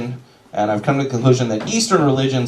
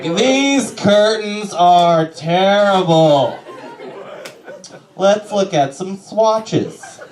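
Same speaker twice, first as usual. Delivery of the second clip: campy decorator; 6 semitones higher, much slower than usual. Retold or imitated. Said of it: "I'm just like".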